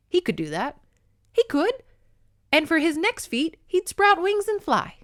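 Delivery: sarcastic Scottish read rising high; mock a wry Scottish narrator, climbing up; Scottish accent. Reader mocks his boast in Scottish accent, rising sarcasm. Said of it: "trying the Scottish thing".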